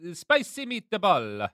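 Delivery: put-on high, slow hammy Italian accent; Italian accent, stretched out. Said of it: "say you in an Italian accent".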